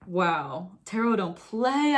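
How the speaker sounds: ordinary talk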